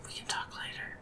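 very soft, voiceless whisper; whispered reply. Acted. Companion putting off a private talk, whispering.

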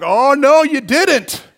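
loud, high mock indignation; oh no you didn't, full sass; defiant outrage. Boy squaring up to the lady, loud indignant disbelief. Defiant kid facing hostile driver.

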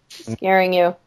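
low, emotionless reply; deadpan character voice; no emotion. Acted. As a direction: say it with all feeling drained out. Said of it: "with no emotion in his voice".

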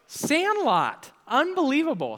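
high, loud exclamation, falling sharply; playful outcry. Real, not acted. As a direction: cry out in mock disbelief. Crowd guesses the quoted movie.

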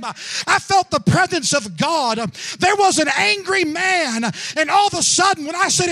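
loud, rising testimony to a shouted climax; full-force preaching. Real, not acted. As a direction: drive each phrase harder, building to a shout.

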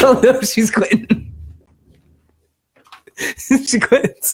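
high, laughing mock alarm; laughing mock panic. Real, not acted. Host laughing as co-host walks off, mock panic. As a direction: squeal it through laughter.

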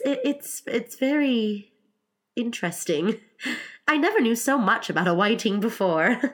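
high, hesitant, halting politeness; falter, then pitch it up; polite Alice. Acted. Alice thanks the Griffin for explaining, high and hesitant.

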